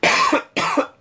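harsh chesty cough. Real, not acted. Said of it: "I'm coughing here".

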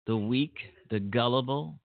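ordinary talk

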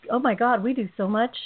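ordinary delivery

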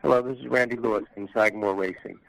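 ordinary delivery

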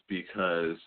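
ordinary talk, nothing marked

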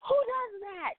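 high, disbelieving question; incredulous. Retold or imitated. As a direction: ask it like you can't believe it. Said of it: "I'm like".

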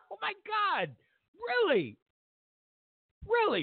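high incredulous really. Real, not acted. Mocking disbelief at media.

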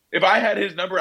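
normal talking voice

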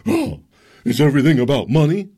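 high, loud sneering mock question; hammed-up preachy critic voice. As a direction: lampoon the righteous critic. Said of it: "You say".

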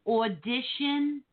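a mock Jersey drawl on water, audition slow; imitating her Jersey accent, water said wah-tuh. Host teasing Christy by mimicking her Jersey accent. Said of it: "Did you hear Christy say".

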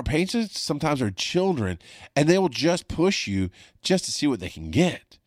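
usual speaking voice

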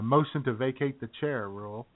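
ordinary talk

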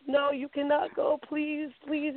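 worried mother praying her daughter won't skydive; pleading mom. Retold or imitated.

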